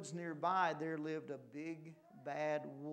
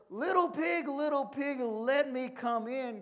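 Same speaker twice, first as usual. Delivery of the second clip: high, loud wolf's demand; big theatrical storybook cry; 8 semitones higher, 8 dB louder than usual. A narrator's reading. Wolf at the pig's door, crying out. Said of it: "cried the wolf".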